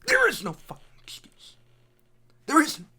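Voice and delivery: high, loud outburst; angry shout. Real, not acted. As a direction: yell it at the screen. Peak of angry rant.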